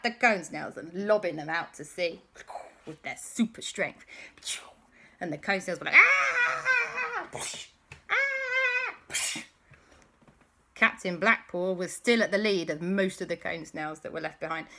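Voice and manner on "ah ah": long, very high mock scream; falling scream. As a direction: wail like someone flung into the distance. Snails thrown out to sea.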